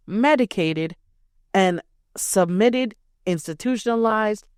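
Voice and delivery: ordinary talk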